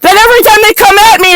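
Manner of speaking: a furious rant on the edge of tears; shouting rant. Real, not acted. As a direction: yell it out, voice cracking at the end.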